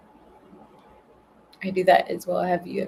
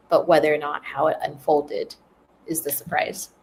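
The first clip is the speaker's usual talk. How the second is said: ordinary talk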